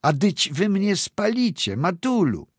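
put-on high, panicked scream of a burning girl; screaming in terror. A narrator's reading. Girl screams to her mother that they will burn her, high and panicked. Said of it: "w niebogłosy krzyczała".

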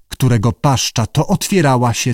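plain reading voice

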